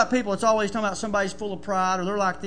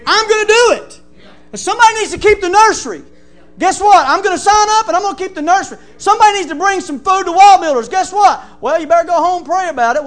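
higher, much louder, driving the sermon home; preaching at full force; 7 semitones higher, 10 dB louder than usual. Acted. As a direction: preach it hard to the congregation.

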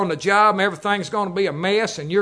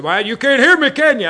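retold loud question; 5 semitones higher, 5 dB louder than usual. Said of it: "grabbed me back there and said".

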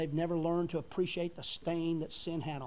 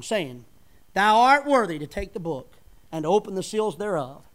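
booming King James reading; scripture preaching; 2 semitones higher, 9 dB louder than usual. Acted. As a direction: proclaim the verse to the congregation. Reads Revelation in preaching voice.

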